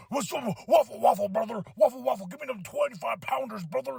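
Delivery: gym shout in a hammed-up Hulk Hogan voice; Hulk Hogan impression. Hammed-up Hogan voice calling for weights. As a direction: bellow it like a wrestler hyping up. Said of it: "trying to be like Hulk Hogan".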